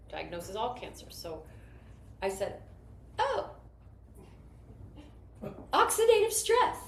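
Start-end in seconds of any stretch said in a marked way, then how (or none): 3.2-6.8 high, loud and eager "oh, oxidative stress"; thrilled know-it-all reply; excited exclamation. Retold or imitated. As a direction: burst out with it like you know the answer.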